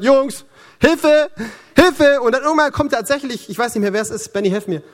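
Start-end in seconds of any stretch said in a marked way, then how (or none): 0.0-2.2 higher, pleading mock shout for help; mock cry for help. Tired Moses calling his friends for help, mock shout. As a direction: play tired Moses calling his men half in jest. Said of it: "er schreit".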